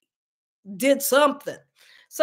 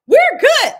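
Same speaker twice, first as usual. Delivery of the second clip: high, loud cheerful declaration; we're good, hammed-up triumph; mock confident; 14 semitones higher, 11 dB louder than usual. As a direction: announce it's all fine, beaming. Husband and wife, job done.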